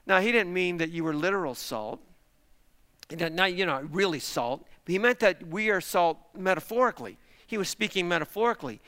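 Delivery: usual speaking voice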